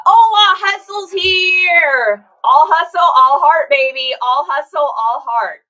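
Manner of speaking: loud slow hype call, all hustle all heart; loud hype shout. Real, not acted. Cheering a viewer who just arrived, loud hype. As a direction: pump it up, ringmaster style.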